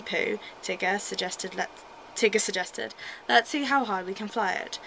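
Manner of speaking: put-on reading a children's story aloud; lively storybook reading; story narration. Reads a Winnie the Pooh story.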